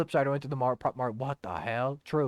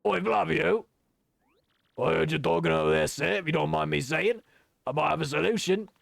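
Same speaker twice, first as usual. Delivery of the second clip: rough-and-ready sailor role; sailor character; 2 semitones higher than usual. Acted. Patch offers Flavio a solution, sailor voice. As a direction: play a deferential old deckhand.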